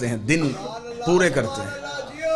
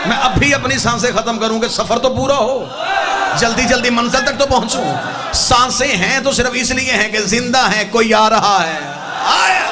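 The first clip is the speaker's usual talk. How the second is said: loud, raised sermon delivery; impassioned preaching; 5 semitones higher, 6 dB louder than usual. Real, not acted. Preacher swears to congregation he awaits death, loud impassioned preaching. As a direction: preach it out to the whole crowd.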